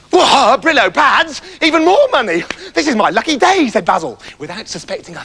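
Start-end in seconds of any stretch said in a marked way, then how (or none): 0.1-3.7 high, loud, delighted; thrilled at more money; excited. Acted. Basil thrilled at the treasure offer.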